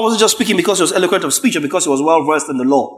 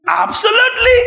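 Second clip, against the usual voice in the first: emphatic shout. Real, not acted. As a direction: shout it out in full agreement. Emphatic agreement.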